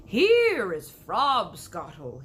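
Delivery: slow, loud proud announcement; proud cry. Acted. BFG holding up his bottle, slow proud loud announcement. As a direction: present it like rare wine. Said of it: "he cried".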